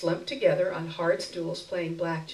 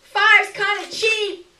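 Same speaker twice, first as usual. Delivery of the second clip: much higher, louder, slow complaint; grumbling beggar woman; 14 semitones higher, 9 dB louder, slower than usual. A narrator's reading. Beggar woman complaining to narrator, slow high grumble. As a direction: drag out the complaint, unimpressed.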